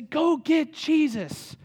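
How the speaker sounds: drawn-out urgent plea for Jesus; desperate plea. Retold or imitated. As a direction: beg like the sisters sending word. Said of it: "They're crying out and saying".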